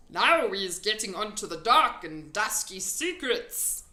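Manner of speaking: loud, folksy friendly giant. A narrator's reading. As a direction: play the big kind giant.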